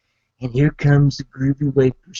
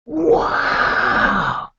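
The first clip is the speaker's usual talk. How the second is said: high shouted wow of surprise; amazed wow; 12 semitones higher than usual. Retold or imitated. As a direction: blurt it out, floored by the empty room. Walking into an empty restaurant.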